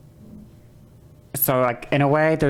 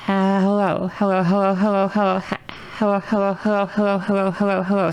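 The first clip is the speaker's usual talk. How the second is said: higher, steady trained voice on repeated hellos; higher-resonance voice demo; 4 semitones higher than usual. Shown as a demonstration. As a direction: repeat it like a vocal exercise, pitched up. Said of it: "my frequency is higher".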